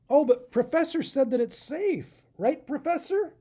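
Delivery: put-on high, bright eager kid voice; rising hope on Professor; eager young raccoon. Adam eager about his machine.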